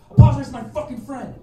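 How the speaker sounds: loud, high acted yell; furious demand. Dave hits him and demands apology, acted furious yell. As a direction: act out losing patience and yelling the order. Demand escalates after second punch.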